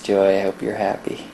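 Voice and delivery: ordinary talk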